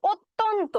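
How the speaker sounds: অত্যন্ত said as o-tyonto with a closed o; closed o sound. Shown as a demonstration. Teacher contrasts অত্যন্ত with অনেক for students. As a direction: begin অত্যন্ত on a closed, rounded o. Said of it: "ও ধ্বনির মতো উচ্চারণ আসছে".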